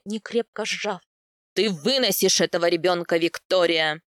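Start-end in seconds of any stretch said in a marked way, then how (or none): 1.5-4.0 loud, low, commanding bark; barked order. A narrator's reading. Степняк ordering Виктория to carry the child, barking. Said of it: "рявкнул Степняк".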